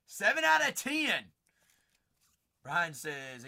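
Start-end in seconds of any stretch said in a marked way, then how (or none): none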